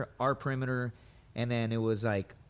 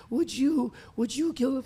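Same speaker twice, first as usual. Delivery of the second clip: high, louder mock sobbing plea; imitated drunken sobbing; 13 semitones higher, 5 dB louder, slower than usual. Drunk senior Marine sobbing with a bottle of Jack Daniels, imitated crying. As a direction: wail it like a drunk crying baby. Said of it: "he's sobbing like a baby".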